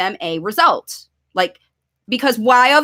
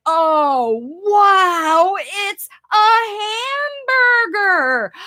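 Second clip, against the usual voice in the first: excited wow; 7 semitones higher, slower than usual. Shown as a demonstration. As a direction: build to the hamburger reveal. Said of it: "made me so excited".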